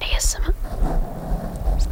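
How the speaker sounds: slow, drawn-out whisper; mock-ASMR, all breath. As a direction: whisper it slowly into the mic. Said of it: "Does that sound good?".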